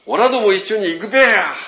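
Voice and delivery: loud, high rustic Tohoku call; Tohoku dialect. Retold or imitated. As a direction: belt out オラども一緒に行くべや in thick Tohoku dialect. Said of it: "そんな綺麗な言葉で言ってなかった". (東北弁で大声で呼びかける)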